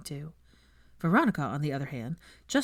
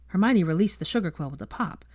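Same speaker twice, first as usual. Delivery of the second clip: ordinary delivery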